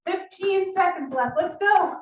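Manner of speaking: upbeat pumped-up workout cues; aerobics instructor. Acted. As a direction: cheer them through the last seconds. Coaching live cardio set.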